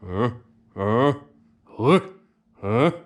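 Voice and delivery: short guttural uh sounds pushed from the diaphragm; throaty quack grunts. Shown as a demonstration. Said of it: "you wanna make a ... like that".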